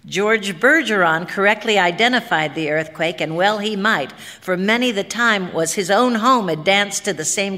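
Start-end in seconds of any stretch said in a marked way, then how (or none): none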